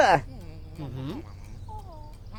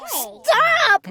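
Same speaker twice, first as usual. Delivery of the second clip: yelled. A narrator's reading. Sister yelling at the glunk on the phone. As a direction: shout to make him quit. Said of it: "my sister yelled".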